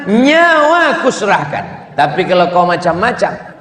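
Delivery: high, loving pledge turning to a warning; mock devoted husband. Husband to wife, high and devoted, then warning. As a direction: swear it all away, then threaten.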